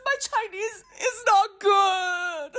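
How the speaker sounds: high, slow hammed-up wail; fake-sob the punchline; mock crying. Comic lament over her poor Chinese, hammed-up sobbing.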